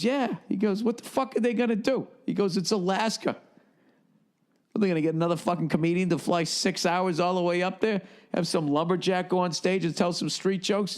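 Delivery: tossing off the question with a laugh in it; amused Ralphie. Retold or imitated. Retold Ralphie scoffing at the club.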